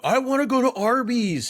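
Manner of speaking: high-pitched quoted craving; exaggerated high imitation; mock pleading impression. Imitates someone wanting Arby's.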